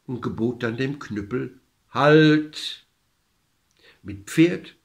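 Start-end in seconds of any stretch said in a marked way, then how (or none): 1.9-2.9 louder, firm order to stop; commanding call. A narrator's reading. Old man orders the cudgel to stop, commanding call. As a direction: call out a single loud command.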